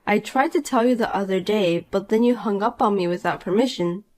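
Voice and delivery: usual speaking voice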